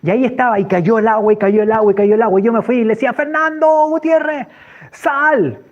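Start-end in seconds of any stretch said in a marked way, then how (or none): 3.1-5.6 high, drawn-out holler; calling out. Retold or imitated. Man calling his friend out into the rain, hollering. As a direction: call him from outside the house.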